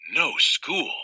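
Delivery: plain reading voice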